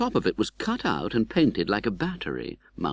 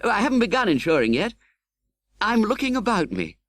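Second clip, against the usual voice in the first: lightly voiced young gentleman, a bit higher; young friend's reply; 11 semitones higher than usual. Young friend proudly telling Pip his trade, lightly voiced. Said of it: "I asked him".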